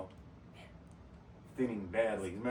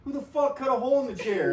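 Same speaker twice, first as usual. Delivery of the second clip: higher, louder mock boss outburst; angry boss; 9 semitones higher, 6 dB louder than usual. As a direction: demand it like the furious team lead. Boss Greg angrily asks.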